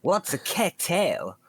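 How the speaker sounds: high, slow mock American; exaggerated American accent, stretched out. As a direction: play a baffled American. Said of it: "done an American accent".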